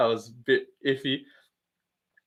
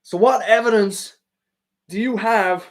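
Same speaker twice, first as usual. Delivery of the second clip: put-on higher, louder hillbilly accent; Bryce Mitchell hillbilly voice; 7 semitones higher, 5 dB louder than usual. Streamer doing Bryce Mitchell for chat, hillbilly accent. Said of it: "inbred hillbilly voice mode".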